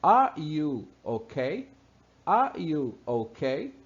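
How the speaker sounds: Are you okay spelled R U OK, slowly; slow English demo. Shown as a demonstration. Teacher showing texting shorthand aloud, slow demo. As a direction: read the letters slowly as a question. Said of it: "a pergunta que tá no ar pra você".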